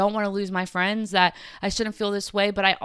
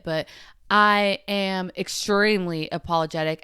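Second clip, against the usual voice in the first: normal talking voice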